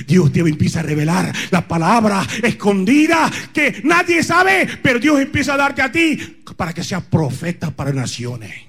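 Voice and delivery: shouted, fiery sermon; preaching at full force. Acted.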